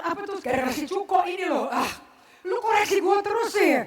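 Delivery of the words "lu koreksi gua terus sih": annoyed retort. Retold or imitated. Congregant annoyed at being corrected.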